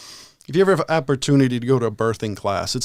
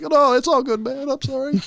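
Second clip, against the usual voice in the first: sheepish; 12 semitones higher than usual. Retold or imitated. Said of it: "It's like".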